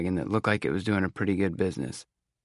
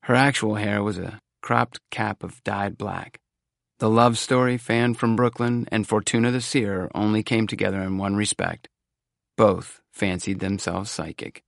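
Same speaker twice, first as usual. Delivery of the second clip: usual speaking voice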